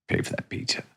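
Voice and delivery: near-whispered, dead quiet "pay for that pizza?"; quiet menace. Retold or imitated. Father asking his son one last time, threatening hush. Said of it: "And he goes".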